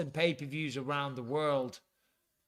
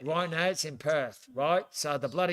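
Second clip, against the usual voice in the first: put-on Aussie accent; mock-earnest Australian impression. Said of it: "segue out of that impression".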